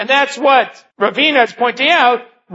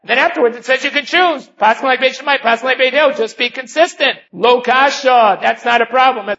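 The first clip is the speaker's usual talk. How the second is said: ordinary talk, nothing marked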